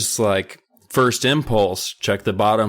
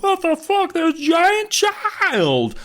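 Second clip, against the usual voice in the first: shocked driver; slower than usual. Retold or imitated.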